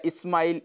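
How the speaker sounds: स्माइल said as "ismail"; mispronunciation with added i. Shown as a demonstration. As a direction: put a short i before स्माइल. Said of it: "जो कि गलत है". (स्माइल को इस्माइल कहना, गलत उच्चारण)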